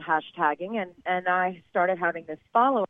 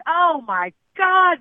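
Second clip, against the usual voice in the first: pained cry; 9 semitones higher, 9 dB louder, much slower than usual. Retold or imitated. Pain from nipple clamp, retold.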